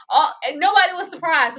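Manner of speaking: ordinary talk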